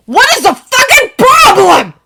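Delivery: high, loud tearful roar. A narrator's reading. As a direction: nearly roar it. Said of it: "he almost roars".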